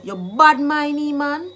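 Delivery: drawn-out, emphatic call-out; accusing, jealousy charge. Shown as a demonstration. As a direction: lean hard on "bad mind". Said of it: "you are jealous of me".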